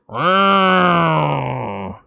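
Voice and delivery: imitated cat growl-meow; drawn-out cat yowl. Storyteller mimics the cat refusing to move, long yowl.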